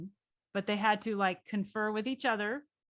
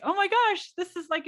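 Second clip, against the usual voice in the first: high, louder burst of delight; 10 semitones higher, 6 dB louder than usual. Retold or imitated. Newcomer discovering a client's Southern accent, delighted. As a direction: exclaim it with sudden wonder. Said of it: "like, delightful".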